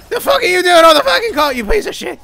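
loud, high-pitched outraged yell; angry shout. Real, not acted. Player yells at enemy on the cart, angry shout.

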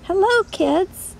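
ordinary delivery

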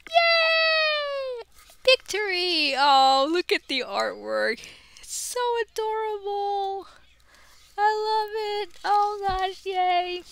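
gasp, then a high, loud delighted cheer; high-pitched thrilled yay; excited shriek. Real, not acted. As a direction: squeal with joy at the pull. Cheering at the artwork.

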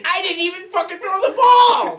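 real indignant shout; outraged yell. Real, not acted. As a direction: scream it in disbelief. Outraged at game.

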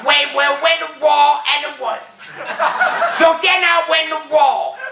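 high, indignant mock-Japanese accent; angry Japanese businessman. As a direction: rant in a caricatured Japanese accent, pitched high. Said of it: "this little Japanese bloke got really angry".

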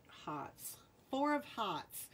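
Rhode Island accent, r dropped in "Hearts" as "hahts". Shown as a demonstration. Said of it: "That's my Rhode Island accent".

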